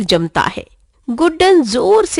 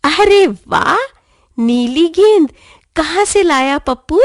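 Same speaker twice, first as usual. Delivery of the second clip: sweet voice; 6 semitones higher, 3 dB louder than usual. A narrator's reading. Guddan admires the new ball in a sweet high voice. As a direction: sound sweetly delighted. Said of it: "मीठी आवाज में".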